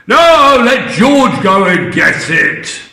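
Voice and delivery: loud high No, then slow commanding; bossy Grandma. Acted. Grandma ordering George to fetch tea, loud bossy. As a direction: order it done.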